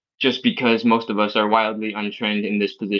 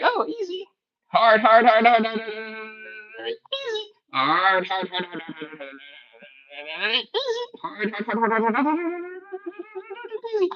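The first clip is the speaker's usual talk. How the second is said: strained student; 9 semitones higher than usual. Retold or imitated. Coach mimics students doing reps.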